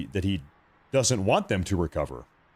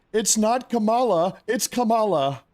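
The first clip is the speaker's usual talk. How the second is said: much higher, slow, smug correction; mock pedantic corrector; 10 semitones higher than usual. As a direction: correct the name like a fussy know-it-all. Mocking pedants correcting a name.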